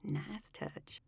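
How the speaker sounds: soft unvoiced whisper; whispered thought. A narrator's reading. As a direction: whisper it to yourself, amused. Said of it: "Delaney thought".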